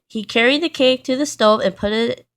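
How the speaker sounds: ordinary delivery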